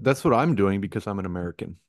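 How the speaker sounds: normal talking voice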